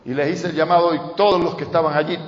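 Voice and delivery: ordinary talk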